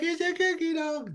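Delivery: put-on very high baby talk to a dog. Shown as a demonstration. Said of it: "That's the way I talk to my dog".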